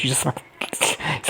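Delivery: hushed, breathy aside; whispered aside. Real, not acted. Vlogger hushes mid-recap, whispering. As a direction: drop to a whisper. Aside during a retold quarrel.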